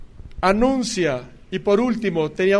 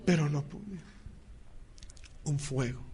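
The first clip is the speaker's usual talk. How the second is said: hushed intensity; 3 semitones lower, 14 dB quieter than usual. Acted. The fire burning in the prophet.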